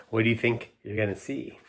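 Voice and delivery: ordinary delivery, nothing marked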